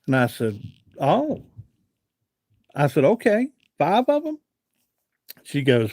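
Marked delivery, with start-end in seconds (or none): 1.0-1.4 surprised oh. Retold or imitated. Reacting to five boxes demand. 3.0-4.4 high, surprised question; incredulous. Retold or imitated. Repeating the woman's demand back, high and incredulous. Retelling shock at five boxes.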